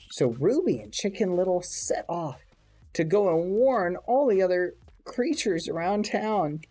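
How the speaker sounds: put-on animated reading to children; lively fairy tale voice; storybook narration. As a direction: tell it like a bedtime tale. Tells Chicken Little story.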